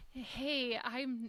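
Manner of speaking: retold sad friend. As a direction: imitate a downcast friend, quietly. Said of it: "he was really sad".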